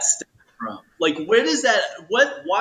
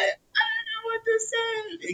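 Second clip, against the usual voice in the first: mock crying; 4 dB quieter, slower than usual. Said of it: "I was just like".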